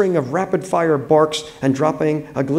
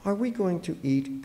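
small, soft, raised pitch; fragile voice; 6 semitones higher, 8 dB quieter than usual. A narrator's reading. Wife after the quarrel, in a fragile voice. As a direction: ask it softly, as if fragile. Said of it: "Connie said in a fragile voice".

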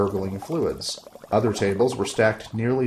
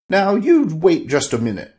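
loud, higher protest; protesting sorcerer; 8 semitones higher, 7 dB louder than usual. Acted. Sorcerer protesting to his wife, loud. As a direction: cut her off, loud.

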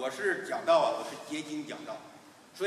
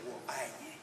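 soft I love you; 5 semitones lower, 12 dB quieter than usual. Acted. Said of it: "等你喊“我爱你".

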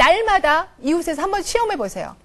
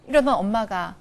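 ordinary talk, nothing marked

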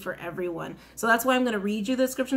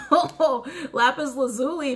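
laughing; 3 semitones higher, slower than usual. Real, not acted. Laughs on drawing the card.

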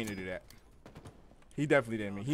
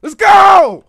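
triumphant shout; 25 dB louder than usual. Real, not acted. Gamer celebrates a kill.

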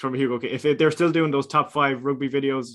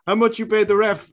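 loud, higher-pitched mock French accent; bad French accent; 7 semitones higher, 6 dB louder than usual. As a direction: accuse the ref in a clumsy French accent. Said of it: "not a very good French accent".

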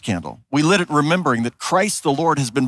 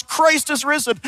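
retold shout; 9 semitones higher, slower than usual. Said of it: "at the top of their lungs".

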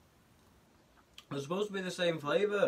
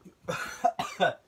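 coughing; 5 semitones higher, 3 dB louder than usual. Real, not acted. Sour candy makes him cough.

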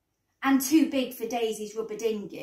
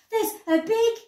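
high, louder, urgent announcement; calling out; 11 semitones higher, 6 dB louder than usual. A narrator's reading. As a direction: call it out in a high child's voice. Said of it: "called Daisy".

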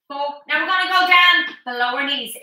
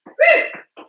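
whoop; 13 semitones higher, 3 dB louder than usual. Real, not acted. Instructor cheering mid-workout.

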